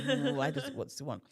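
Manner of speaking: a hint of a sulky teenager; mock teenager sulk. Mimicking her teenage attitude toward the insistent healer, mock sulky. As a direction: grumble it like a bored teen. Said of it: "proper teenager behavior".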